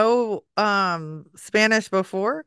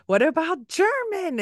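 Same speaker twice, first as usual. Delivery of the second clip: high, pleading mock parent question; mock exasperated parent; 10 semitones higher than usual. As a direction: play the exasperated parent nudging her. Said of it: "I'm like".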